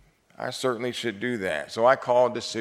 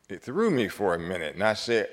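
ordinary delivery

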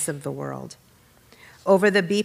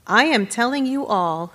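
narrated shout, higher-pitched, restrained in volume; raised, insistent voice; 7 semitones higher, 4 dB louder than usual. A narrator's reading. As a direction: announce it to everyone in a contained shout. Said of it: "he shouted in Arabic".

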